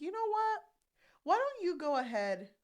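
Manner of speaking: high, loud mock-breezy offer; hammed-up sending someone away; acted dismissive suggestion. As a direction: toss it out high and loud, as a put-on. Voices Rain brushing someone off.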